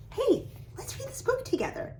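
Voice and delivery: inviting. Shown as a demonstration. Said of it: "the way that you do it".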